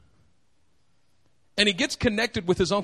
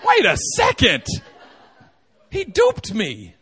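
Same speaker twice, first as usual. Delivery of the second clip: dawning realisation; 4 dB louder than usual. Retold or imitated. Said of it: "you walk away going".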